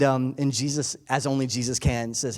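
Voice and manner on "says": ordinary talk, nothing marked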